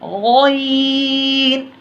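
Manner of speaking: आइन long from the throat, गैन long and heavy; drawn-out ain and ghain. Shown as a demonstration. Teacher reciting आइन and heavy गैन for pupils. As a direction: stretch both letters, गैन thick. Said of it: "गैन को भी मोटा पढ़ना है".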